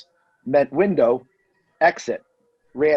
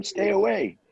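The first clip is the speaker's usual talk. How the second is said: retold yell; 3 dB quieter than usual. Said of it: "he's yelling at them".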